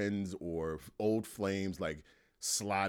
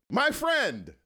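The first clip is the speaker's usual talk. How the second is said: excited greeting; 9 semitones higher, 7 dB louder than usual. Real, not acted. Host greeting his guest, loud and high with joy. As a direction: throw your arms open with your voice.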